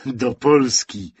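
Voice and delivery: plain reading voice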